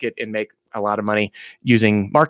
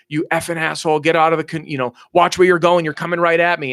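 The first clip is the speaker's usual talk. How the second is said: higher-pitched, half-strength irate shouting; mock angry yelling; 5 semitones higher than usual. Man yelling at an oncoming canoe, acted angry warning. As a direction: play an indignant boater telling someone off. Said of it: "a guy's yelling at the canoe".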